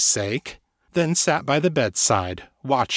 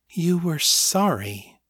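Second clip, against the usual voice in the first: slow, higher woman's voice; said slowly; 6 semitones higher, slower than usual. A narrator's reading. Puzzled woman slowly testing a rare word. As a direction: weigh each word, unsure of it. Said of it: "she said slowly".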